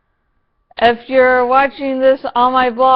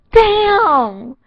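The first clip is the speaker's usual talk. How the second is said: higher, drawn-out let-down "Damn"; disappointed damn; 9 semitones higher, 3 dB louder than usual. Retold or imitated. Fan learning Lori survives, a let-down damn. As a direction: groan at a dashed hope. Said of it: "and then I'm like".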